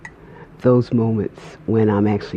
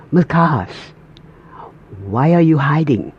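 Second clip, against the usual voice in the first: imitated Nigerian accent, higher and louder; 5 semitones higher, 4 dB louder than usual. Pastor calling out a hiding choir member, Nigerian accent. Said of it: "in his Nigerian accent".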